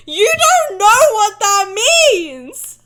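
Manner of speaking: high loud mocking outburst; exasperated shout. Real, not acted. Streamer yelling at wine snobs, exasperated shout. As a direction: yell it at the pretend snobs.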